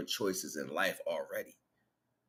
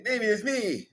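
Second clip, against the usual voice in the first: high, loud put-on Chinese accent; pleading "baby it's me" in a hammed-up Chinese accent; mock Chinese accent; 8 dB louder than usual. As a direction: ham up a Chinese accent as the dead man back in a new body. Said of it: "accent of the Chinese".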